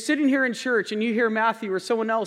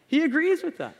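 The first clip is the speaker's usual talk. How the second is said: laughing; 7 semitones higher than usual. Real, not acted. Repeats punchline laughing.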